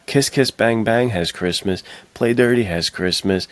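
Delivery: ordinary talk, nothing marked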